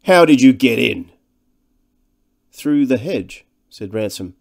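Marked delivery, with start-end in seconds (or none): 0.0-1.1 loud, blunt demand; overbearing big man's challenge; loud-voiced bully. A narrator's reading. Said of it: "his massive and loud-voiced companion". 2.5-3.5 a touch of irritation, pitched up; louder, mildly peevish reply; slightly ill-tempered. A narrator's reading. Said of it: "felt a little ill temper".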